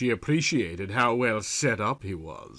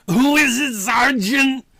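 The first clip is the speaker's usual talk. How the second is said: growled question; 12 semitones higher, 6 dB louder than usual. A narrator's reading. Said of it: "Andrew growled".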